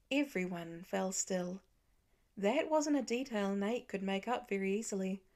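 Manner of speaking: usual speaking voice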